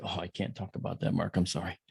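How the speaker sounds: soft, regretful refusal; hushed apology. Retold or imitated. Chief declining to tell Mark, soft low apology.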